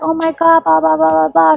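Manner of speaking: high, monotonous parody of gushing; mock gushing. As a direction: rattle it off high, imitating her chatter. Mocking the client's gushing.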